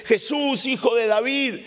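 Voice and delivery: gritando más agudo y fuerte; grito suplicante. Acted. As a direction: clamar a Jesús por encima de la multitud. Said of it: "empieza a gritar, da gritos y voces". (grito suplicante)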